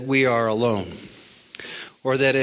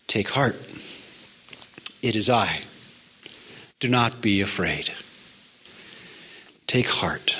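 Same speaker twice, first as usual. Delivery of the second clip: tender reassurance; 7 semitones lower, 5 dB quieter than usual. Retold or imitated. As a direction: reassure the frightened gently, pitched well down. Jesus calming his frightened disciples.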